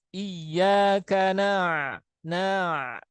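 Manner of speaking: very slow Iyyaka na-, held; slow recitation. Shown as a demonstration. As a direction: pull Iyyaka na- out slowly. (Iyyaka na- dibaca sangat pelan)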